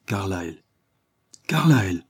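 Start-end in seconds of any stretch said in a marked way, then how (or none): 1.5-2.0 higher and louder astonished echo of the name. Acted. Sir Francis hears his rival's name, astonished. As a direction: echo the name in sheer disbelief. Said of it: "au comble de l'étonnement".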